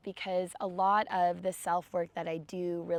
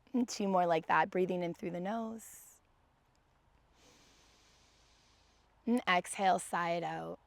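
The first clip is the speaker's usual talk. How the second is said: gentle guided meditation read. Acted. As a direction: ease the class into stillness. Leads seated breathing meditation.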